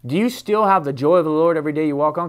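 usual speaking voice

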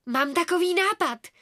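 high, loud burst of enthusiasm; excited exclamation. A narrator's reading. Girl announces an idea to friends, excited. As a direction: call it out, swooping up. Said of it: "zvolala jsem nadšeně".

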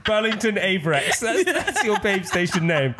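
high giggling through the words; laughing. Real, not acted. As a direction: laugh out the silly name. Laughing at the silly name.

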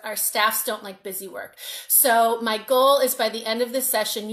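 ordinary talk

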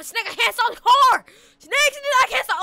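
loud, high, frantic shout; role-played panic at full volume; panicked yell. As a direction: yell in alarm as the car won't stop. Acted runaway car, brakes failing.

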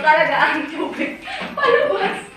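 laughing through the words; laughing, pitch jumping on palabas. Real, not acted. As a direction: giggle as the water pours out. Laughing while telling of flood.

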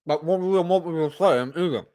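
mumbled through pretend food; mouth full. Eater answering his girlfriend with his mouth full, muffled mumble. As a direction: garble the words as if mid-chew. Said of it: "Why do you talk with your mouth full?".

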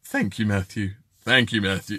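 low-pitched mock lady; female voice attempt. Host offering Karen another version, low-pitched female impression. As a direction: drop the put-on lady voice right down. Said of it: "which is the closest".

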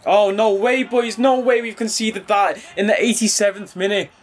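can't believe they conceded that late; shocked disbelief. Real, not acted.

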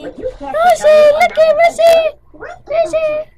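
high, loud excited calling; calling the dog. Real, not acted. Girl excitedly calling her dog Rissy, high and loud.

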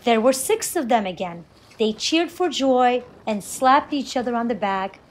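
usual speaking voice

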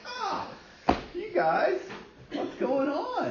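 high, hammed-up disbelief at the crowd; mock surprise. Pastor retelling his reaction to the crowd, in high mock dismay. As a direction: play it as a comic, squeaky whine of disbelief.

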